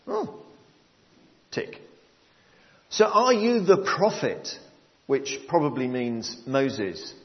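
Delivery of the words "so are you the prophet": questioning priests. Retold or imitated. Priests check John's authority, high insistent questioning. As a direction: press the question like an interrogator.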